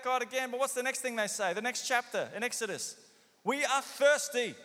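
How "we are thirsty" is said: grumbling whine. Acted. Israelites grumbling for water.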